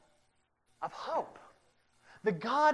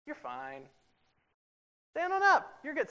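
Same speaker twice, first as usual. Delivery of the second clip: calm parent; 6 semitones higher than usual. Retold or imitated. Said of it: "Here's what we do".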